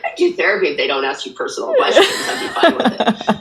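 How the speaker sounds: quick, giggling delivery of a favorite joke; laughing through a joke. Retold or imitated. Guest laughing as she tells the host her therapy joke. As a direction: laugh the punchline out.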